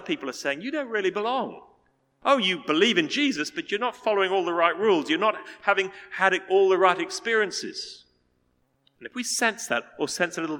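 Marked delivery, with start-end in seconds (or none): 0.6-8.0 loud, high, scornful accusation; accusing voices. Acted. Critics telling believers they don't belong, in loud scornful accusation. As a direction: hurl it like a judgemental critic.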